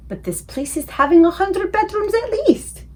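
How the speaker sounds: a touch of a giant gaping at the palace; amazed giant. Acted. The giant staring at the huge palace, awed.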